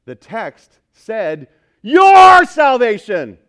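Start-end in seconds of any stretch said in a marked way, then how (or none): 1.8-3.4 "Your" shouted high and held long; slow, booming blast on "Your", then falling; emphatic YOUR. Shown as a demonstration. Preacher showing what the text stresses, "Your" shouted emphatically. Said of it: "how else to emphasize it".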